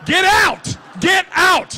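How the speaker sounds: high, loud playful yell; mock outraged shout. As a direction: bellow it as if throwing out a punster. Host mock-ejects someone for a pun.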